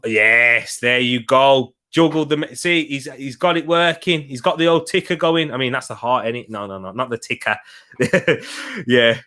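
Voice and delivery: usual speaking voice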